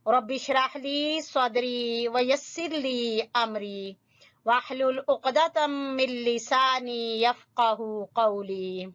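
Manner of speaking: slow, measured Arabic invocation; prayerful recitation. Acted. Teacher praying before the lesson, slow measured recitation. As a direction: recite with long, drawn-out vowels.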